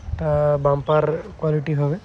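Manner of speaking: usual speaking voice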